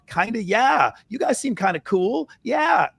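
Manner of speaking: mock rapport-seeking in a very high voice. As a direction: imitate a man going squeaky to please. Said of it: "there's a higher pitch".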